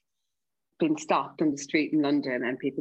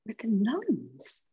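slow, incredulous whisper; shocked whisper; 4 semitones higher, much slower than usual. Retold or imitated. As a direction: breathe it out in disbelief, barely voiced. Shocked aside about the nuns.

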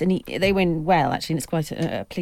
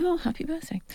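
soft, high, near-whispered happy birthday; mock-hushed quote of the manager; hushed greeting; 9 semitones higher, 6 dB quieter than usual. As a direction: breathe it gently like a kind word in passing.

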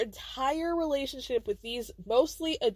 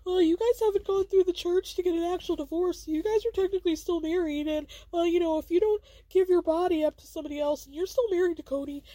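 mock screaming; 7 semitones higher than usual. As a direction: imitate someone screaming, without a full yell. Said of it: "when Robin was screaming about how".

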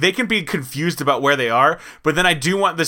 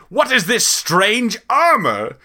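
slow, raised, baffled knight; archaic knight voice; 7 semitones higher, much slower than usual. Acted. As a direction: drawl it out like a lost medieval knight. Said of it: "They're like".

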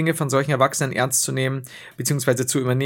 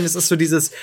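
ordinary delivery

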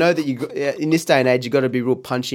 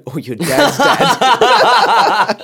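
laughing; 11 semitones higher, 7 dB louder, much slower than usual. Real, not acted. Punchline breaking into laughter.

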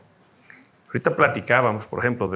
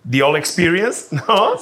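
laughing; 6 semitones higher than usual. Real, not acted. Laughing at Avatar joke.

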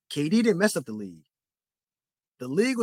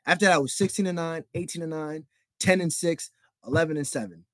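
ordinary talk, nothing marked

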